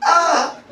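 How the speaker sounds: high-pitched excited exclamation; imitated excited "ah". Preacher shows how she expressed excitement, mock high cry. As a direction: mimic her high burst of excitement. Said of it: "then she expressed like this".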